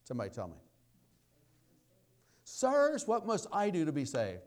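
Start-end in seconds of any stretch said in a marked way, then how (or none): 2.4-4.4 loud, high cry to the men in charge; acted jailer's outcry; urgent plea. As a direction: call it out as a desperate question.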